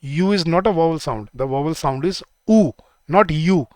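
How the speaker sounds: यू said as yoo, ऊ as pure oo, slowly; slow pronunciation demo. Shown as a demonstration. Teacher showing students ऊ versus यू, slowly. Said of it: "आप English में यू बोलते हो".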